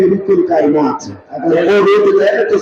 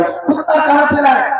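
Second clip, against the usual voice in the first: higher, with a chuckle in it; amused retelling; 8 semitones higher than usual. Retold or imitated. Preacher re-quoting the thug's taunt to the congregation, chuckling. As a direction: repeat the thug's jibe laughing at it.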